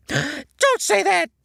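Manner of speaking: horrified cry. A narrator's reading. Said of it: "SpongeBob gasped".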